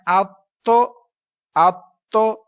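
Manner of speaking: اتو with the t firmly held before a loud release; held doubled letter. Shown as a demonstration. Teacher shows how to do the doubling, firmly held اتو. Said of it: "تو جماؤ کیسے کرنا ہے".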